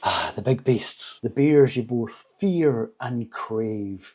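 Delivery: slow, drawn-out mock-epic delivery; dramatic build-up. Reviewer hyping the big beasts, slow and dramatic. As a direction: stretch fear and crave for drama.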